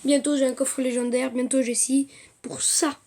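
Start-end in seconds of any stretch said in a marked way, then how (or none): 2.4-3.0 hushed, whispered repetition; fading to a whisper. Real, not acted. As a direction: repeat it under your breath. Répétition qui finit tout bas. (répétition chuchotée)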